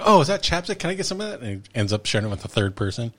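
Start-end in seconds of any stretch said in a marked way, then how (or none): 0.0-1.4 higher, eager mooch voice; eager sketch character. Retold or imitated. Sketch character asking to share chapstick, eager. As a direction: beg for chapstick, lightly voiced. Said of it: "he's like".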